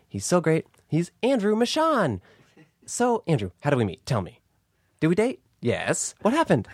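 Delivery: swooping, drawn-out host voice; Alexi Wasser impression. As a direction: mimic a gushing podcast host introducing a guest. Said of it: "Do an impression of me".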